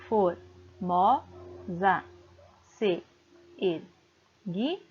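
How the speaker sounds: very slow, separated syllables of "Formosa se erguia". Shown as a demonstration. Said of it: "Vamos fazer a divisão".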